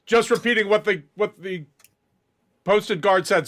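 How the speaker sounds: guard nervously passing the blame upward; defensive nervous guard. Acted. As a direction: cover yourself in front of the officer. Guard defensively explains to officer.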